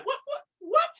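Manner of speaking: high, shouted disbelief; what what what, almost crying out; shocked cry. Real, not acted. Shocked podcast host cries out in disbelief. Reacting to gallon of nut.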